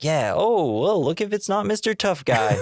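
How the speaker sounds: high, swooping sarcastic greeting; mock taunting. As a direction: taunt him like a hammy movie villain. Retold villain taunting his victim.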